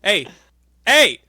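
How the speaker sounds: sudden loud high "hey!"; calling out. Retold or imitated. Edge at an empty hotel desk, loud call. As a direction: shout to get attention.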